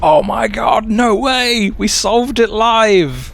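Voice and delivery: high, loud triumphant shriek; shrieking jackpot winner. Real, not acted. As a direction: scream it like you won. Puzzle solved, shrieking with joy.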